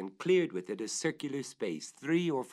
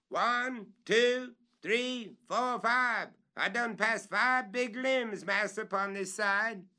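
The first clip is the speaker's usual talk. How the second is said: much higher, louder counting, slow at the end; counting aloud; 13 semitones higher, 4 dB louder, slower than usual. Acted. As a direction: count the limbs out loud from above.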